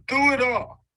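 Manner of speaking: high, loud cry of faith; emphatic shout. Real, not acted. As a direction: proclaim it like a preacher. Testimony climax, shout heard.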